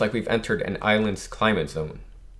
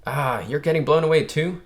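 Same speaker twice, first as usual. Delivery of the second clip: raised voice over the storm; shouting into wind; 4 semitones higher than usual. Acted. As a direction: call out against strong wind. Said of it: "He's like".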